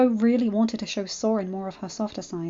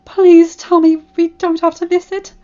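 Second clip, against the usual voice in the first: high, loud pleading; begging; 8 semitones higher, 13 dB louder than usual. Acted. Twilight begging not to miss the gala, high loud pleading. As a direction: plead desperately. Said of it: "Twilight begged".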